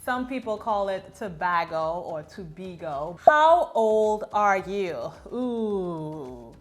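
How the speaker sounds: usual speaking voice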